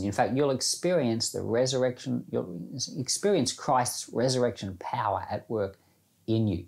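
usual speaking voice

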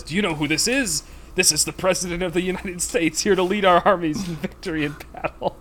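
a hammed-up furious bluff at the gate; increasingly angry bluff. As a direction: bluff the guard with growing indignation. British guy bluffing guard, rising anger.

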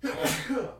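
sudden real sneeze. Real, not acted. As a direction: let out a big sneeze. Sneeze just announced.

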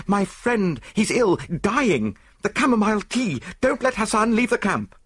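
high, loud, frantic alarm; desperate shouted warning; panicked plea. A narrator's reading. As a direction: cry for help as your friend is dying. Friend thought dying, calling for help.

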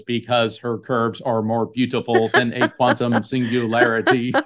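imitated synthesizer voice, evenly pitched; Stephen Hawking. Guest retelling actress's imitation of the physicist, robotic monotone. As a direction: mimic a computer speech device, word by word. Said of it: "she imitates Stephen Hawking's voice".

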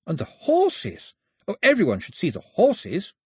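high, wonder-filled storyteller; enthusiastic Pilou. Acted. Pilou tells Mao of trouser men's wonders, high and enthusiastic. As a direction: marvel aloud, pitched well up.

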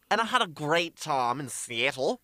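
lightly put-on accent picked up in Seattle; put-on Seattle-trip accent. Showing off an accent picked up with a friend, joke accent. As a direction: drawl the vowels in a joke accent. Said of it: "talk at each other in that accent".

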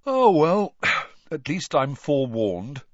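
high, yawning sigh of resignation. A narrator's reading. Ron to friends over his predictions, yawning. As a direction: talk through a big yawn. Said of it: "Ron yawned".

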